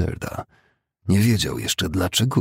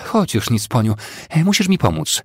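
higher, louder urgent call; urgent plea; 6 semitones higher, 4 dB louder than usual. A narrator's reading. Breathless woman on the veranda asking for help, urgent and high. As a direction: press for help in a raised voice.

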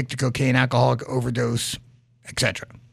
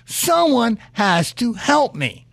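exasperated plea; 8 semitones higher than usual. Retold or imitated. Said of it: "the guy goes like".

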